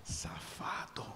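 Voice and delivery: hissed, whispered scornful safado, slow; mocking whisper. The crazy ones whispering about the son, mocking whisper. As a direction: breathe Safado out as a sneering aside. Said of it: "os malucos ficam assim".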